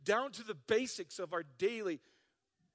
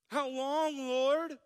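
higher, louder whining plea; whiny; 5 semitones higher, 6 dB louder, much slower than usual. Acted. Pastor reading a psalm as complaint to God, whiny. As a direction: whine it out as a loud complaint. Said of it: "read this with a whiny voice".

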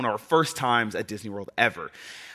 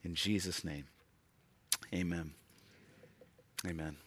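prayer; 8 semitones lower, 15 dB quieter than usual. Acted. Closing prayer, quiet.

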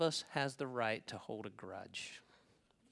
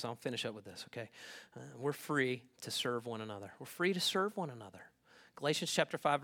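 ordinary delivery

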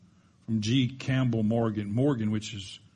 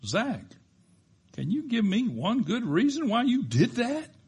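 exasperated dad; 11 semitones higher than usual. Retold or imitated. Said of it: "I gasped in exasperation".

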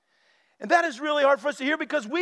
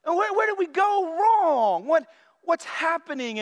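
bewildered protest; 4 semitones higher than usual. Retold or imitated. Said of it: "I'm like".

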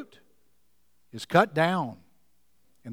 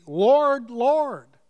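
fervent plea; 4 semitones higher than usual. Retold or imitated. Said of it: "they're fervent about it".

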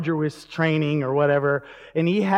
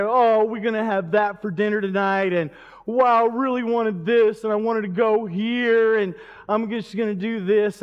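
higher-pitched imitation of a complainer; mock whining; 4 semitones higher than usual. Kid grumbling about dinner and wants, mock whining. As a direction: whine it out like a let-down kid. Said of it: "you just didn't whine about it".

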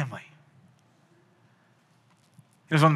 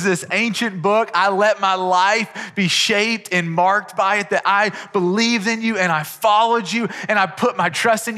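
raised, louder impassioned preaching; preaching at full force; 6 semitones higher, 4 dB louder than usual. Acted. Pastor urging congregation toward faith, impassioned preaching. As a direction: build toward the glorious moment.

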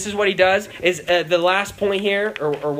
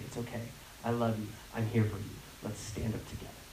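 lower, much softer, hushed tender voice; comforting; 9 semitones lower, 14 dB quieter than usual. Retold or imitated. Leader holding a hurt kid, tender and hushed. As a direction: hold them and soothe quietly.

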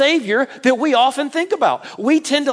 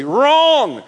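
usual speaking voice

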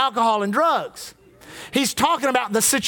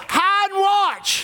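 defiant; 6 semitones higher, much slower than usual. Retold or imitated. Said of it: "I tell the devil".

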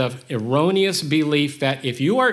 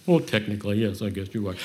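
lower, softer reluctant admission; grudging concession; 6 semitones lower, 3 dB quieter, faster than usual. Retold or imitated. Speaker reluctantly conceding to God, low and grudging. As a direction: give in grudgingly, mumbling it.

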